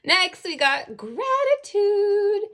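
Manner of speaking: loud, very high, drawn-out reveal; slow, soaring squeal on GRATITUDE; playful announcement. Pretend. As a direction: sing out the card's name in a high voice. Announcing the next card playfully.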